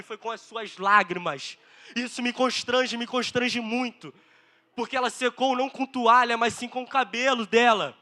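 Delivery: fervent, emphatic sermon delivery; impassioned preaching. Real, not acted. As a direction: hammer the key words to the congregation. Preaches with raised force.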